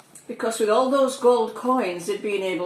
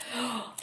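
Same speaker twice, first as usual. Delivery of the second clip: gasp; 4 semitones higher, 9 dB quieter than usual. Acted. Dramatic gasp in story.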